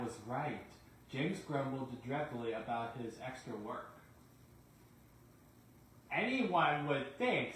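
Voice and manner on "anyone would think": slow, louder, lightly voiced sly remark; drawn out with a knowing dig; sly engine. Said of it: "said Douglas".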